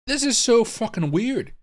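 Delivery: high, slow, baffled exclamation; real bewilderment. Real, not acted. Streamer hoping somebody can message and explain, bewildered. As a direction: draw it out high in disbelief. Live bafflement at strange market data.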